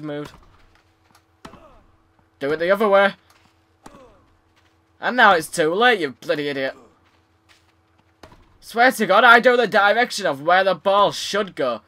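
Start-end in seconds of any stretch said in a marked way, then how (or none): none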